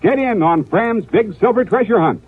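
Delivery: ordinary delivery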